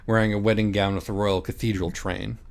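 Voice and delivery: plain reading voice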